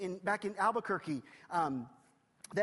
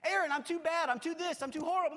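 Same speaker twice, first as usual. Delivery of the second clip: high, loud cry of a self-condemning believer; desperate protest; 8 semitones higher, 6 dB louder, faster than usual. Pretend. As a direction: wail it out as the hopeless sinner. Imagined listener protesting unworthiness.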